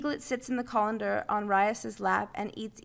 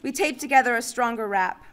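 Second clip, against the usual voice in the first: plain reading voice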